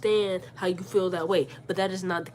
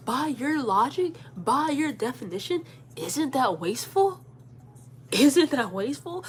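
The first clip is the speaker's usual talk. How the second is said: higher, slow, rising questions; rhetorical challenge; 5 semitones higher, slower than usual. Retold or imitated. Daughter imagines turning wasteful charge back, pointed and slow. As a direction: drive each question home with stretched words.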